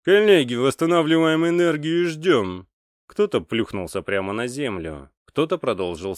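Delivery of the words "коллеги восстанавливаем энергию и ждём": loud, raised commanding voice; commander's voice. A narrator's reading. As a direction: address the mages like a field commander. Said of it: "Командир сказал". (громкий командирский голос)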